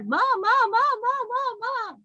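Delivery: put-on high, eager child calling her mother; a small daughter running to her ma; excited little girl. Daughter Manju voiced by narrator.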